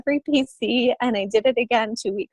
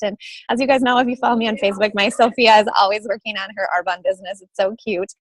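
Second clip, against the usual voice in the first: ordinary talk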